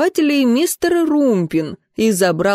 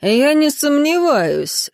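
resonant voice; 6 semitones higher, slower than usual. Acted. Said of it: "раздался звучный, сильный голос".

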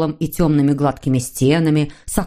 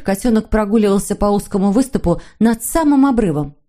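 ordinary delivery

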